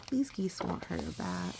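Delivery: ordinary delivery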